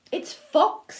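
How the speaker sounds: high, lilting reveal; sing-song storybook excitement; bright announcement. A narrator's reading. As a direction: announce the newcomer to small children. Mouse announces fox in story.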